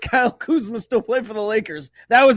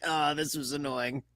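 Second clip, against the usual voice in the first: quiet mock grumble; 6 semitones lower, 10 dB quieter than usual.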